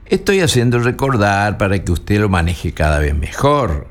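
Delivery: normal talking voice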